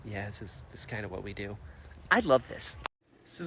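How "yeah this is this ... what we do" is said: low, much softer, offhand shrug; too cool to care; deadpan low understatement; nonchalant. Retold or imitated. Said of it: "he's like".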